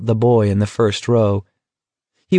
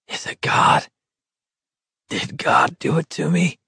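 weak whisper; 3 semitones higher than usual. A narrator's reading. Feverish man questioning God.

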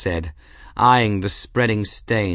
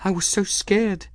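high-pitched, acted tearful voice; 11 semitones higher than usual. A narrator's reading. Girl begins to cry, telling the cat her fear, tearful. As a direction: confess it high, as if starting to cry. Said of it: "she did begin to cry".

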